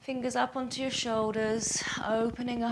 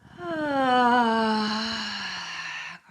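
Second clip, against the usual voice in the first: long, very loud falling exhale; noisy sigh; 9 dB louder than usual. Shown as a demonstration. Teacher repeating the release for students, long loud sigh. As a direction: heave out a big, noisy sigh. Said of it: "And again".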